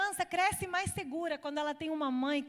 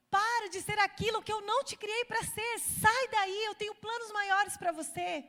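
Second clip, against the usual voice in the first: God's voice calling out; 6 semitones higher, 3 dB louder than usual. Acted. Voicing God calling her out.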